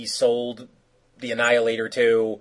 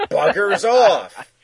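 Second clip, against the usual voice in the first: laughing; 7 semitones higher, 6 dB louder, much slower than usual. Real, not acted. Laughs while telling it.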